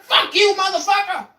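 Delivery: high, loud curse; hammed-up cursing old man; furious shout. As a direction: yell it back at him.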